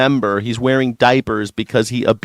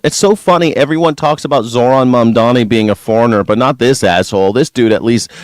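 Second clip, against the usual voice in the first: normal talking voice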